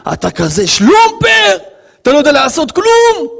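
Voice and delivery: high, loud public put-down; acted scolding. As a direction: berate an employee before everyone. Acted public insult at work.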